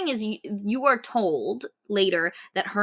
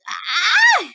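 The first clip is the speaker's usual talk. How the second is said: pained yelp; 12 semitones higher, 5 dB louder than usual. Retold or imitated. Mock head stuck in vent.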